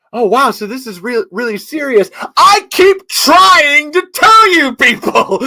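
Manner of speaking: high, exasperated quote stretched out at the end; imitating Jody. Host quoting Jody, exasperated and high, slow at the end. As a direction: play Jody fed up that nobody listens. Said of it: "Jody just saying".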